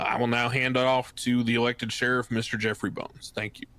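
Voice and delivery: ordinary talk, nothing marked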